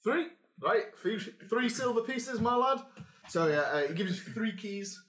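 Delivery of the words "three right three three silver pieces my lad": higher Northern barkeep counting coins; the innkeeper in Northern English; northern accent. Acted. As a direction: GM as barkeep, telling the players the price in a Northern accent. GM voicing barkeep.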